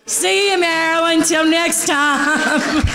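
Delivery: a fond farewell breaking into laughter; laughing goodbye. Real, not acted. Saying goodbye to Marilyn with a laugh. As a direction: send her off, chuckling. Farewell toast to late aunt.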